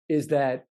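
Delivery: usual speaking voice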